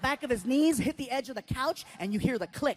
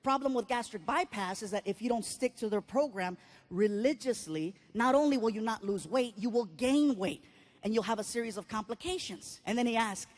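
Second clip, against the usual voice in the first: ordinary talk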